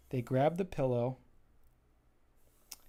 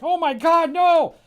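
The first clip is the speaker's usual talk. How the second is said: much higher, loud panicked cry; panicked shriek; 13 dB louder, slower than usual. Acted. Pillows seeing Jobbot coming, high loud panic. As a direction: shriek in terror at the killer bot.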